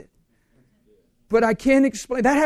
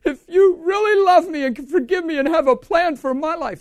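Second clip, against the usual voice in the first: high, loud, imitated wail; retold bawling plea; 8 semitones higher, 5 dB louder than usual. Preacher reliving his plea for forgiveness, bawling plea. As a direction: plead upward as if bawling. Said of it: "I'm bawling".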